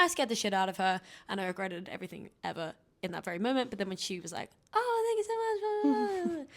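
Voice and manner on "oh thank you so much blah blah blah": imitating her thanks.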